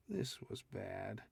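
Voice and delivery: soft, slow, dreading; quiet dread. A narrator's reading. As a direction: let the dread sink in quietly. John's dread at alien suns.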